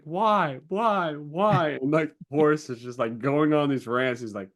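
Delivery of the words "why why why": put-on higher, repeated child's question; insistent mock-child pitch; pestering child. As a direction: imitate a kid asking over and over. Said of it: "moment where the child's like".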